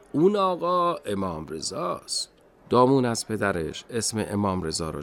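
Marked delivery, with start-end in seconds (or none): 0.1-2.3 put-on higher, slow old man's voice; smiling old man. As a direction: answer the child slowly, with a smile. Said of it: "پیرمرد با لبخند گفت".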